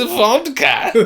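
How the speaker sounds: high, very loud blurted shout; imitated schoolkid's bellow; proper loud. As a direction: yell it out like the kid in assembly. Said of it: "Just proper loud".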